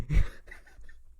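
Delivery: short burst of laughter; amused laugh. Real, not acted. Narrator laughing at a dramatic section title, amused. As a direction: crack up at your own words.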